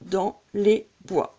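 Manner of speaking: slow scansion, syllable by syllable; deliberate, measured verse count. Shown as a demonstration. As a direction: arbres split as "ar-breu", each beat apart. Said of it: "On tombe également sur un alexandrin".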